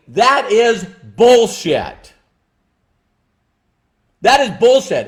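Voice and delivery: furious, deliberate condemnation; emphatic anger. Real, not acted. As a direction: slam the excuse down hard. Angry rejection, swearing.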